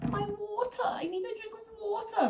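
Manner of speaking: put-on high little-girl whine; toddler voice. Toddler asking mum for water, in a high childish whine. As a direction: plead like a small child stalling bedtime.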